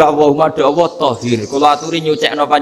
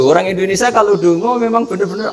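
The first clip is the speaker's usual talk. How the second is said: ordinary talk, nothing marked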